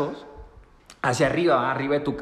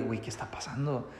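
scared inner voice; 4 semitones lower, 12 dB quieter than usual. Retold or imitated.

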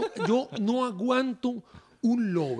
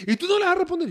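dad's voice, much higher and pushy; dad urging his son to reply, pitched high; prodding dad; 10 semitones higher, faster than usual. Retold or imitated. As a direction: needle your son into answering.

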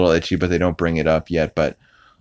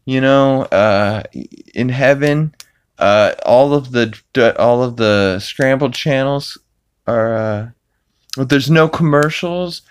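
Peter impression; 6 semitones higher than usual. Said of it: "Peter's like".

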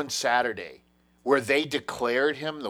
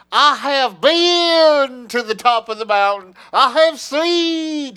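much higher, louder and slow, like a pulpit preacher; mock preacher Hillary; 12 semitones higher, 9 dB louder, much slower than usual. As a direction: ham up a booming sermon voice, stretching each word. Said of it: "where she's trying to channel, Sharpton".

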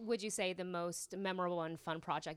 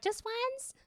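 incredulous. Retold or imitated. Retelling mom's sewing lesson.

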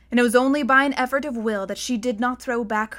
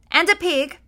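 Kandar's voice; 6 semitones higher, 5 dB louder than usual. A narrator's reading. Mummy voice Kandar.

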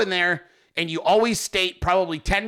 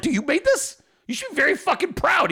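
high-pitched, excited congratulation; re-enacted impressed praise; retold amazed praise; 11 semitones higher than usual. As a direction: gush with surprise, pitch way up. Retells praising Hogman's good meme.

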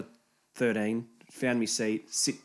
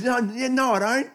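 put-on high flustered teen voice; flustered kid; 13 semitones higher, 6 dB louder than usual. Teen admits he lives with his mum, high flustered voice. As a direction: stammer it like a caught-out kid.

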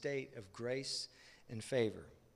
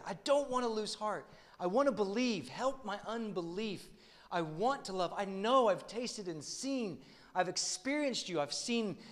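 a believer begging God for help, pitched up; pleading prayer; 7 semitones higher, 4 dB louder than usual. Retold or imitated. Voiced prayer of a struggling believer.

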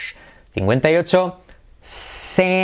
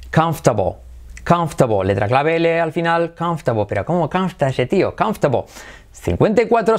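normal talking voice

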